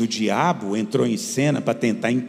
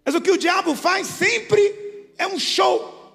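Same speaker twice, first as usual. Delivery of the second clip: preaching at full force; 13 semitones higher than usual. Acted. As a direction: build to a fervent peak. Preaching climax.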